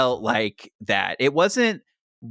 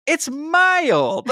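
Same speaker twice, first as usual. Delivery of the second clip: mocking The Rock. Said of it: "He goes".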